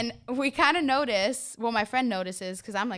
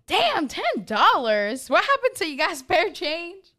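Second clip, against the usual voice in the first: mock disbelief; 7 semitones higher, 5 dB louder, slower than usual. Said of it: "So the girl next to us says that".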